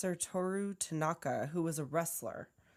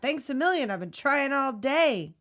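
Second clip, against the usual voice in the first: loud, higher mock thanks; exasperated call; 6 semitones higher, 5 dB louder than usual. Stranded hitchhiker to the driver who stopped, exasperated call. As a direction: call it out, acting fed up.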